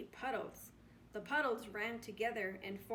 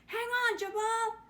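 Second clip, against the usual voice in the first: high, loud urgent shout; anxious call; 12 semitones higher, 10 dB louder than usual. Acted. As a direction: shout encouragement over the storm. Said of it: "an anxious voice called".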